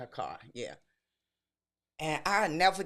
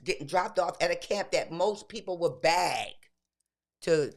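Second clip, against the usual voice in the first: normal talking voice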